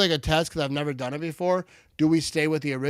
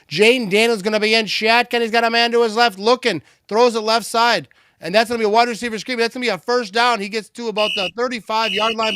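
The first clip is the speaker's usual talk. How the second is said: higher, louder live football call; live play-by-play; 8 semitones higher, 6 dB louder than usual. Real, not acted. Streamer calling a run from shotgun, live play-by-play. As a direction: call the snap and run as it happens.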